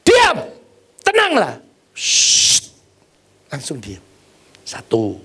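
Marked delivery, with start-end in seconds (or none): 0.1-1.6 much higher, louder command to the storm; commanding rebuke. Retold or imitated. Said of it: "Yesus bangun cuma bilang apa?". 1.9-2.6 hushing. Retold or imitated. Imitated calming of storm.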